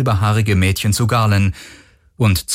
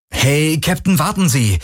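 high, urgent appeal; urgent calling; 8 semitones higher than usual. A narrator's reading. Garland calls after the captain turning away, urgent. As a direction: call after someone to make them wait.

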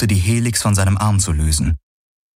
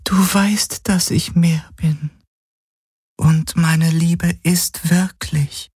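high, slowing, pitiful female figure voice; plaintive pleading; 9 semitones higher, slower than usual. Acted. Tearful illusion insists her love is real, plaintive. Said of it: "Sie klang so kläglich".

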